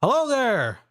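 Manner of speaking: bright, high "Hello there!" called down from a giant mech; booming stranger's hello from on high; high greeting from above. Acted. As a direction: pitch it way up, calling down to the team. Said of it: "and he goes".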